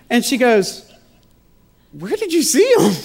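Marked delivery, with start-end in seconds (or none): 1.9-2.9 much higher, louder mock puzzlement; wife puzzled by strangers at nurses' station, high and incredulous; baffled question. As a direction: pitch it up like she can't believe it.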